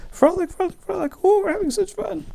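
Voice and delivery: very high, playful maiden voices; giggly Rhine maidens. Acted. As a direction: squeal it like frolicking water nymphs.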